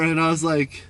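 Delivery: usual speaking voice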